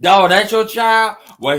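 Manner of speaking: ordinary delivery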